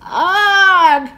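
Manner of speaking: loud high grunt of pain; pained giant yell. A narrator's reading. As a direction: yelp as the rope yanks. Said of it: "yelled the giant".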